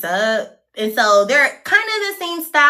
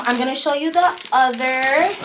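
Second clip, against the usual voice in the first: ordinary delivery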